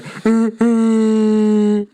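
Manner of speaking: long, loud high horn noise; mock self-congratulatory horn blast; imitated horn toot. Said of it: "toot my own horn here".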